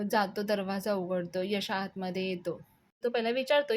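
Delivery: ordinary delivery, nothing marked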